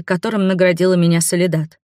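ordinary delivery

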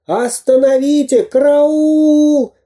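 very high, stretched-out wails; cartoon villain squealing in alarm; panicked cries for help. A narrator's reading. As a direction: scream for rescue, hammed-up. Villain carried away, calls for help.

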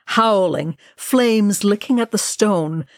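usual speaking voice

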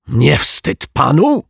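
high, loud outraged yell; indignant shouted reproach; angry yell. Acted. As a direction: shout it at him, shocked and indignant. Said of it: "wrzasnął detektyw Pozytywka".

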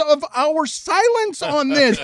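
high, incredulous scolding; retold arguing. Arguing with Greg over spilling the secret, exasperated. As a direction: pitch climbing into an exasperated demand. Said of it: "I was in an argument".

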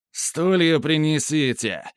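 put-on slurred, drawn-out drunken yell. A narrator's reading. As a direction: call out like a tipsy official. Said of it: "противным пьяным голосом вскричал".

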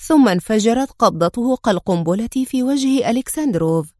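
plain reading voice

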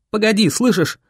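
high-pitched urgent plea to hold on; insistent urging. A narrator's reading. Boy carrying wounded Viola downhill, insistent urging. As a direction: keep her going, pitch lifted. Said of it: "твердил я Виоле".

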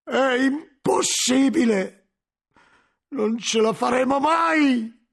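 loud, high, slow cries of defeat; exhausted despair. A narrator's reading. Guglielmo leaning on the doorframe admitting defeat, slow despairing cry. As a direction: give up aloud, each line heavy and drawn out. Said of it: "appoggiandosi esausto".

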